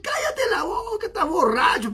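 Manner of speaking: high-pitched mock exasperation; acted-out high cry, easing off by the end; irritated dismissal. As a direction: imitate a cross sleeper shutting someone up. Acts cousin snapping back at him.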